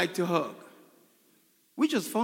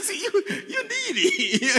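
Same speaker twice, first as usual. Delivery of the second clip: high, drawn-out words through laughter; laughing tease; 11 semitones higher, slower than usual. Retold or imitated. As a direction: tease her while cracking up. Laughs retelling his teasing of wife.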